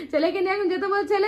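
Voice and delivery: usual speaking voice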